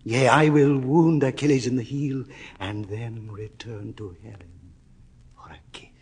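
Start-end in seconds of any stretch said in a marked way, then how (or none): 2.6-5.9 fading to a whisper. Acted. Lover's speech fades to whisper.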